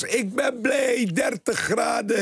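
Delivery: high, drawn-out cry of joy; slow, jubilant radio-host opening; exuberant announcing. Real, not acted. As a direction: stretch the words out high and elated. Exuberant show opening greeting to listeners.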